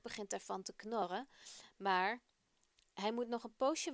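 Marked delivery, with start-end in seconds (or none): none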